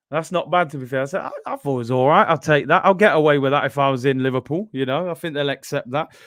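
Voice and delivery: usual speaking voice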